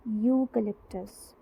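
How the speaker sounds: यूकेलिप्टस said slowly, opening with a clear yoo sound. Shown as a demonstration. Teacher to class, slowly modelling a word. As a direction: spell it out by ear, slowly. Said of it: "Y की".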